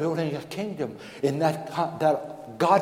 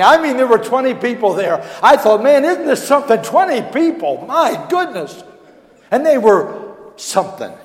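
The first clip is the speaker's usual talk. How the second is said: loud, high, driving sermon voice; full-force preaching; 7 semitones higher, 13 dB louder than usual. Acted. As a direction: preach it out to the back pews.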